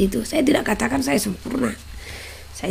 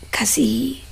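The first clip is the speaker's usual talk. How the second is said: whispered love; 2 semitones higher, 5 dB quieter, slower than usual. Shown as a demonstration. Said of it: "kasihi Tuhan".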